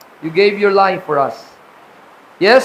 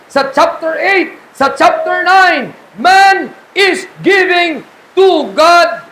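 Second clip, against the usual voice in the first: slow, higher, louder building to a shout; preaching emphasis; 6 semitones higher, 4 dB louder, slower than usual. Acted. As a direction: drive it home from the pulpit. (dahan-dahang lumalakas na sigaw sa pangangaral)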